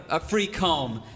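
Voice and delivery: normal talking voice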